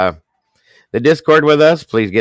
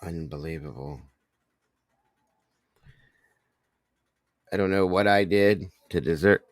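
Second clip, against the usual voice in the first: much softer, choked up; overwhelmed hush; 6 dB quieter than usual. Real, not acted. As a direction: barely get the words out. Overwhelmed by gifts.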